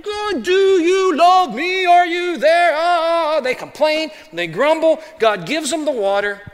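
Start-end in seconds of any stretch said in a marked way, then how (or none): 0.0-3.4 high, loud, slow mock wail; whining Israelites. Israelites whining at God, hammed-up high wail. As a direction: wail like a needy child. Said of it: "They get".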